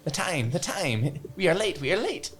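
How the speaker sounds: high, fretful late rabbit. Pretend. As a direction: fuss about being late.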